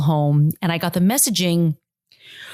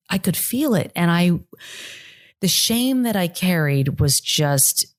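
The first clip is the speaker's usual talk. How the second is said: ordinary delivery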